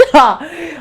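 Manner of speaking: short, high laugh of disbelief; helpless high-pitched laugh; incredulous laugh. Real, not acted. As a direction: burst out laughing at the absurdity. Laughs at the stupidity.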